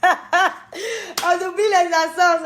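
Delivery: slow, high repeat through laughter; real laughter in Ivorian French accent; laughing. Real, not acted. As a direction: laugh at your own joke. Laughs repeating her own joke.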